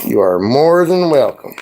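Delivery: ordinary talk, nothing marked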